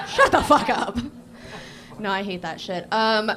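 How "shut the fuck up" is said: higher, louder repeat of the retort; loud mock scolding. Real, not acted. Comic to a friend who cheered the beach, loud scolding. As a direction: shout down the heckler for laughs.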